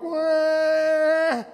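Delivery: a short mock baby wail; mock whimper wah. Preacher mocks Lot's prayer with a babyish wah. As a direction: whimper it like a whiny child. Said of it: "sounds more like a whine and a whimper".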